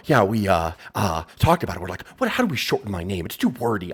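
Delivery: low, soft mocking imitation; mocking Edge. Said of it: "social media video out, like, the next day going".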